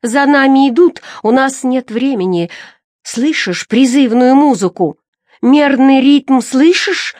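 high, loud, feverish excitement; frenzied urging. A narrator's reading. Madwoman hearing music that calls her to dance, frenzied. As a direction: urge them on with mounting fervour.

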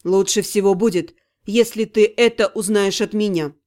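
a man breaking bad news gently. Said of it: "сказал он".

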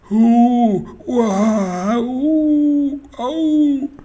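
very high, drawn-out howling moan; whimpering wail.

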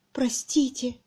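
hushed, breath-only plea; whispered apology. A narrator's reading. Miss Twitterton seated by the fire, whispering. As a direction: whisper it timidly.

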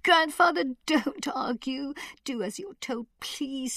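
put-on high, hushed girl's plea; pleading. A narrator's reading. Susan begging grandfather to obey, high pleading. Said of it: "Susan pleaded".

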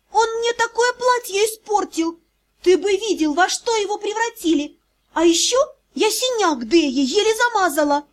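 high, indignant complaint; indignant girl. Acted. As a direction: complain in outrage. (возмущённо, высоким голосом)

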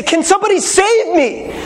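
high, pleading cry for help. Retold or imitated. Preacher voices a drowning addict, high and pleading. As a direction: call out for someone to save you. Depressed person crying out.

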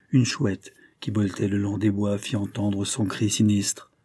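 ordinary delivery

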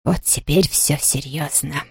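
low, soft, slow whisper. A narrator's reading. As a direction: drop to a hushed voice. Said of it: "перешла на шёпот".

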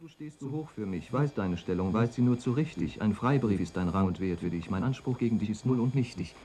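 measured, solemn verse reading; lofty poetry reading with rising and falling lines; poem recital. Acted. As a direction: recite it like a sonnet on stage. Solemn sonnet recital read to the cloud.

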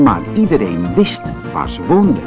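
ordinary delivery

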